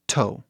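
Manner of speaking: TOE with a forceful puffed T; strong English T. Shown as a demonstration. Teacher reminds learners English is stronger, hard T in TOE. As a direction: hit the T of TOE with air. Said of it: "o inglês é bem mais forte".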